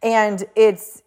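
ordinary talk

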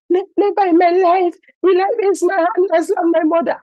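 put-on high, small child repeating the rule; little boy reciting. Son reciting his mother's rule back to her, small and high. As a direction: recite it back like a scolded kid.

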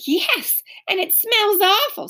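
lightly voiced young girl; girl voice, high. Beezus voiced in story.

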